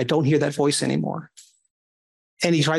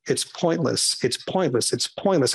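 nagging inner voice; 5 semitones lower, slower than usual. Retold or imitated. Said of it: "that voice kept coming out".

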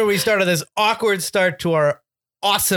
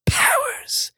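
dramatic high voice; slower than usual. Pretend. Dramatic mock reading.

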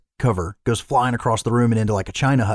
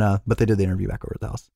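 ordinary talk, nothing marked

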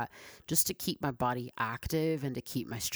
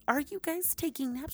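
much higher, pushing the question; even higher repeat; 13 semitones higher than usual. Real, not acted. To viewers about naps, higher squeaky repeat.

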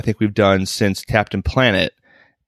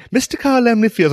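high, sing-song announcer parody; imitating a stage presenter; 13 semitones higher than usual. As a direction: parrot the speech in a high mock voice. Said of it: "kind of the same cadence".

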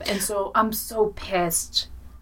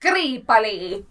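"Creepily" slow and louder, dragged out in a spooky put-on accent; funny accent; 5 dB louder, slower than usual. Shown as a demonstration. Listeners asked for the show name in a funny accent, spooky slow "Creepily". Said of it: "they want us to do it in a funny accent".

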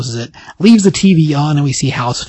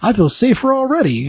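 pitched-up, impressed bar crowd; super excited; 7 semitones higher than usual. Acted. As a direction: play a thrilled onlooker, higher. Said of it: "they're all super excited".

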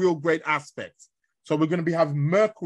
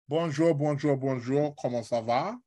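French greeting; 3 semitones lower, 3 dB quieter than usual. Pretend. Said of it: "See, my French is cool".

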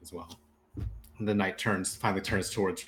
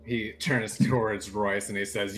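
normal talking voice

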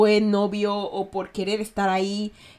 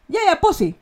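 high, bright "Ya, ya, posi" as a plain yes; affirming posi; 8 semitones higher, 4 dB louder than usual. Shown as a demonstration. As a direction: say "posi" as a cheerful agreement. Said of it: "Afirmación".

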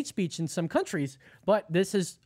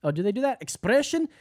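put-on German accent; 3 semitones higher, 4 dB louder than usual. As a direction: read it like a stiff German official. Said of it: "You need to read it in a German accent".

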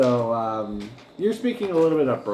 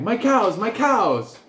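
upset farmer; 9 semitones higher, 4 dB louder, slower than usual. Acted. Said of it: "hear him saying".

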